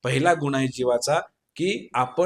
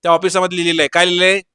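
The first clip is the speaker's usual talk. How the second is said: ordinary delivery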